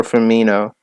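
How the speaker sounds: Firmino ending in a plain "no"; corrected Firmino. Shown as a demonstration. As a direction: say Firmino as "fur-MEE-no", no y sound. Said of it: "Not Firmino".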